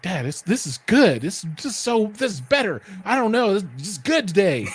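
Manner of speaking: put-on excited kid. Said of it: "He's like".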